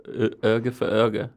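"Uga for Uga" in a put-on Swedish sound, oo-gah; mock Swedish phrase. Shown as a demonstration. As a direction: try the Swedish line, "Uga for Uga". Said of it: "so Five nailed the U".

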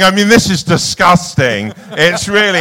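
high, loud mock indignation; imitated outrage. Producer reacting to the trailer toilet, mock outrage.

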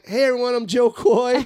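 high-pitched stage greeting; calling to crowd. Retold or imitated. Comic walking out unannounced, calling to crowd.

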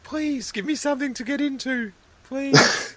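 high, mock-desperate plea; desperate begging. Starved fan begging for something to get into, desperate plea.

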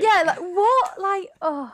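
high, loud disbelieving what; genuinely thrown by the news; surprised. Real, not acted. Shocked by the story.

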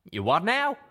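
high, loud, baffled question; stopped cold mid-rant; caught short. Acted. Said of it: "Calamity stopped mid-rant".

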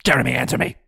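hammed-up angry boss; mock angry demand. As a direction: demand an answer now. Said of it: "calls editor".

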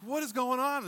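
high, incredulous mock exclamation; mock disbelief. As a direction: act stunned at the absurdity. Reacting to the fans jumping.

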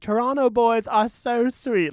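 high, drawn-out celebrity compliment; imitated gracious star. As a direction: imitate a star cooing slowly to a fan. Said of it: "then she was like".

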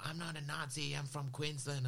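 mimicked indignant denial, high-pitched; protesting man. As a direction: imitate him protesting in a high voice.

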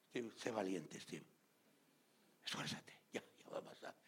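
soft unvoiced whisper; whispered comfort. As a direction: whisper encouragement to someone in pain. Voicing comforter to tortured believer. (susurro de consuelo)

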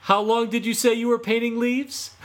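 high, loud gloating taunt; mocking laughter. Fox mocking Autumn while laughing, high and loud. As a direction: Fox crowing over his trick. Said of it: "Fox laughed and rolled".

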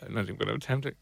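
normal talking voice

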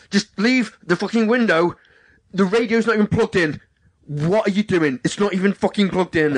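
high, irritated telling-off; retold shouting. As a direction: imitate him snapping at a stranger. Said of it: "He does not want you shouting at him".

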